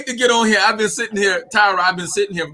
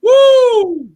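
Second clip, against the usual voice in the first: high, loud cheering woo; high excited woo of pride; joyful whoop; 8 dB louder than usual. Real, not acted. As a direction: let out a big whoop. Overjoyed cheer.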